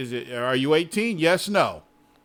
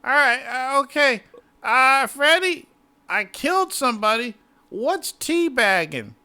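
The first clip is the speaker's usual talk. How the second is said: put-on confused old man; 8 semitones higher, 4 dB louder than usual. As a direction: play a lost old gamer. Confused seventy-year-old gamer.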